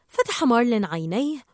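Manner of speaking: ordinary delivery, nothing marked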